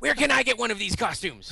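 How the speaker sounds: high, loud disbelieving squeak; astonished Judge. Retold or imitated. Christopher Judge hearing about furries, loud and high in shock. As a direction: play it as total, delighted shock.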